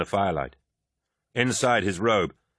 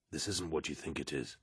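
softer and lower, hushed; 4 semitones lower, 13 dB quieter than usual. Acted. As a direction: keep it low and confiding. Aximand reassuring uneasy Loken.

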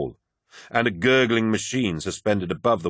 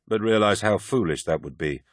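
ordinary delivery

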